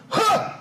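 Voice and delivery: sudden high-pitched "huh!"; flinching gasp. Retold or imitated. Imitating shooters flinching as they fire, startled flinch noise. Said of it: "Like that".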